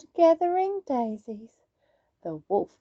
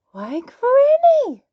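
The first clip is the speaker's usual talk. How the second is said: slow, high, louder, drawn out; slowly; 12 semitones higher, 8 dB louder, slower than usual. Acted. Little Red eyes the strange Granny, slowly. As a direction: stretch each word in wonder. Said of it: "she said slowly".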